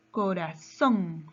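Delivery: "corazón" drawn out, leaning on the final "zón"; slow "corazón" with the weight on "zón"; stress on last syllable. Shown as a demonstration. Teacher showing where the word's force falls, stressed last syllable. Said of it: "su mayor fuerza de voz está en son".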